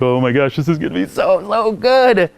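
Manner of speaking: a dad thrilled about the brand new ride; excited. Retold or imitated. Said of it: "And I'm so excited".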